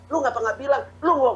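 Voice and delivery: ordinary delivery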